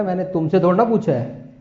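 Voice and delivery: ordinary talk, nothing marked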